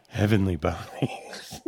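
slow, drawn-out strip club ad tagline; hammed-up slow club ad read; mock commercial voiceover. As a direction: sell it like a late night radio spot. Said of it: "the worst commercial ever".

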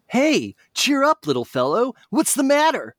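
high, bright-pitched hero voice; Sonic voice. Acted. Sonic turning to the fox who called him, high cartoon hero voice. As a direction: answer like a cocky cartoon hero. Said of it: "said Sonic".

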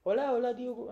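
lightly voiced cheerful doctor; doctor greeting. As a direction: greet the patient brightly as the doctor. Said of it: "ele não falava meio assim".

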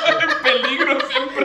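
slow, high laughing line. Real, not acted. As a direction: laugh it out slowly.

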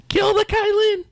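very high, mock kid scream at ordinary volume; screaming small child. Child runs in waving a stick, screaming in a very high kid voice. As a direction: squeal it like a charging little kid. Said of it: "screaming as a small child would".

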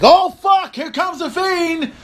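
sudden high yelp of surprise, then a slow drawn-out line; startled fan shouting, then slowing down; excited outburst. Retold or imitated. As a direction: jump out of your chair as the Fiend's lights go out. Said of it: "And I'm like".

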